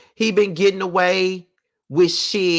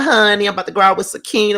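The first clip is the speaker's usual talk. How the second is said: normal talking voice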